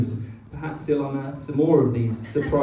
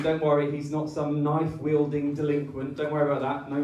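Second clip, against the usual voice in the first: ordinary delivery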